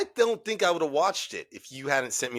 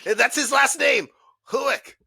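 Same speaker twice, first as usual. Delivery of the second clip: excited; 11 semitones higher, 4 dB louder than usual. Real, not acted. Excited discovery of the name.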